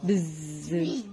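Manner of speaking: low soft buzzing; bee buzz. Shown as a demonstration. Mother demonstrates bee sound.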